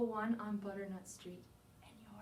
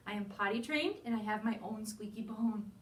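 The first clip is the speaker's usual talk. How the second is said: ordinary delivery, nothing marked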